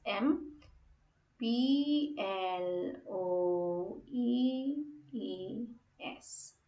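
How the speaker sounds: letters drawn out one by one, slowly; slow letter naming. Shown as a demonstration. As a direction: spell it slowly for the class. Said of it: "So that's how you do employees".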